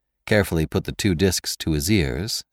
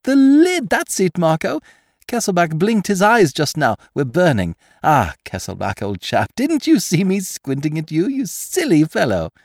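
high, gleeful excitement; delighted thief, pitched far up; revelling with delight; 12 semitones higher, 4 dB louder than usual. A narrator's reading. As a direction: crow with glee at the discovery. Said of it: "He revelled with delight".

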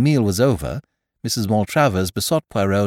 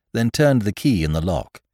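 ordinary delivery

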